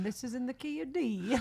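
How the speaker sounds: lightly voiced stage call-out; banjo player's announcement. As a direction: announce the key to everyone, amused. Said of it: "For everybody playing".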